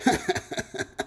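soft acted rueful chuckle; rueful laugh. A narrator's reading. Little man stranded without car, laughing ruefully. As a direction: chuckle at your own misfortune. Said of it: "He laughed ruefully".

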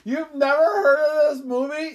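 high, loud incredulous question; shocked disbelief. Real, not acted. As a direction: squeal it in disbelief. Disbelief at friend's answer.

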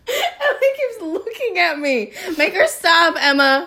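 can't stop giggling while pleading; laughing. Real, not acted. Friend staring makes her crack up.

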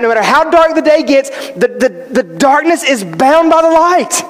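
normal talking voice

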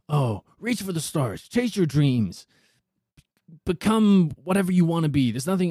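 mocking inspirational slogan voice; mock motivational cliché. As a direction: parrot the reach-for-the-stars crowd, hammed up. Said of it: "'cause everyone goes".

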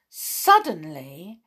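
ordinary delivery, nothing marked

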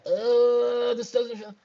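high-pitched imitation of squeamishness; mock icky discomfort. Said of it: "does it feel icky?".

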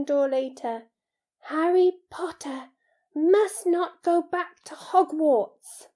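high elf voice, loud praise slowing to grave warning; proclaim, then deliberately warn; Dobby, earnest warning. Acted. House-elf warns Harry off Hogwarts, earnest and portentous.

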